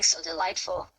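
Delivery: usual speaking voice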